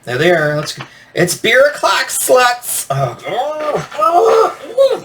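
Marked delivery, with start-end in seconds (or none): 1.2-2.9 put-on very high, loud party girl squeal; cringey high-pitched party girl cheer; obnoxious party girl. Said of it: "party girl voice". 2.9-5.0 high-pitched drawn-out cringe wail; pained, rising "oh" of cringe; cringing groan. Real, not acted.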